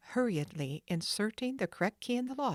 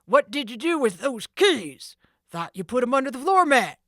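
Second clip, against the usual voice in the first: loud, higher furious demand; angry cry; 7 semitones higher, 11 dB louder than usual. Acted. Sid shouting at Jake over the keys. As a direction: yell at the careless man. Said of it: "Sid cried out".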